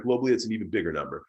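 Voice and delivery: ordinary talk, nothing marked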